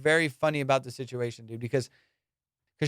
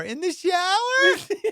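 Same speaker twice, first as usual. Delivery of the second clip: very high, drawn-out question; incredulous; much slower than usual. Retold or imitated. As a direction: imitate her slow, squeaky disbelief. Imitates her incredulous echo.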